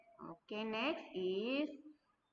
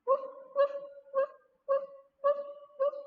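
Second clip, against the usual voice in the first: dog bark=92; 7 dB louder, faster than usual. Shown as a demonstration. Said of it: "What animal says".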